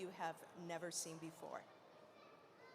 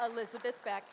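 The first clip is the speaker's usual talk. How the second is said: loud, raised-pitch introductions; announcer roll call; 3 semitones higher, 3 dB louder than usual. Acted. Host introducing poets to the festival crowd, announcer roll call. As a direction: call each name out to a crowd.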